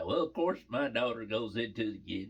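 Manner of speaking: ordinary delivery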